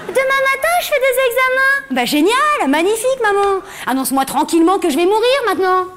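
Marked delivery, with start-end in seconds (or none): 0.1-1.9 higher, breezy mum voice; casual mother voice. Retold or imitated. Mother casually mentions medical tests, higher and breezy. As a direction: toss off the news like it's nothing.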